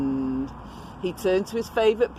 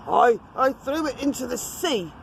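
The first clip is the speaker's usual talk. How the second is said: frightened troll; 6 semitones higher than usual. Acted.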